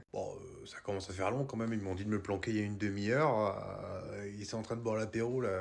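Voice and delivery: low, hushed grumbling; hushed grumble. Acted. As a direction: grumble under breath in hiding. Hidden Santa grumbling. (chuchotement râleur)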